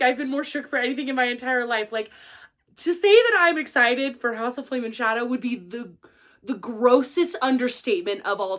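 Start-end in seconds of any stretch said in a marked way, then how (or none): none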